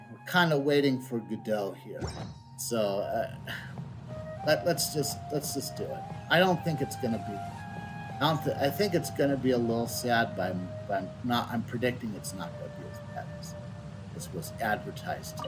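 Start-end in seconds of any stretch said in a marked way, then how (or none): none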